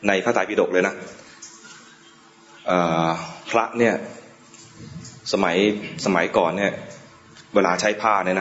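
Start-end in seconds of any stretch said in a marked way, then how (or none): none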